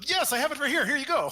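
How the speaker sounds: mock helpful bartender. Player mocks a bartender who has everything, in a high silly voice. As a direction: squeak out an absurdly helpful reply. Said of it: "that'd be quite the campaign".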